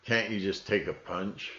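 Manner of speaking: ordinary talk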